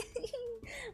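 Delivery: breathy high giggle; quiet wheezing laugh; soft high wheeze of laughter. Real, not acted. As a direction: laugh it out silently.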